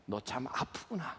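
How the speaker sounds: soft, slow and tender; hushed slow sympathy; gentle empathy. Shown as a demonstration. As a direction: say it softly, feeling their pain. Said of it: "공감해 주세요".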